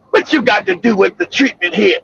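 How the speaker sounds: lightly voiced boss, low pitched; low mock boss voice. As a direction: drop low, mimic the manager asking. Boss quoted, lower voice.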